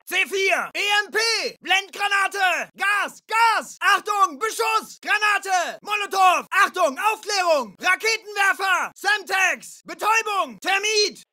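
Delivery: urgent rapid-fire military warnings; shouted danger warning. Acted. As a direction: yell each threat to your squad.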